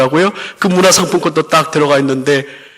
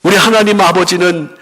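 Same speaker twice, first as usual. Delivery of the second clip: raised, ringing pulpit voice, pressing the point home; preaching; 6 semitones higher than usual. Acted. Pastor to congregation about the loving Father, preaching with fervor.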